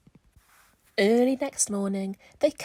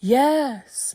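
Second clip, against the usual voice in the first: mooing yes; 3 semitones higher, 9 dB louder than usual. Acted. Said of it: "mooed the cows".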